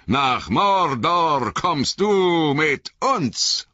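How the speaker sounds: high, very slow, drawn-out threat; menacing wraith call. A narrator's reading. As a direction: call out like taunting wraiths, stretching each word. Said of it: "riefen sie". (drohend gedehnter, hoher Ruf)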